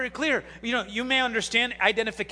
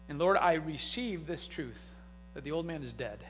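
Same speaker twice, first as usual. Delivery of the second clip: soft prayer; 5 semitones lower, 8 dB quieter than usual. Acted. Pastor modelling a morning prayer, soft and hushed. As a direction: pray it quietly, like waking up.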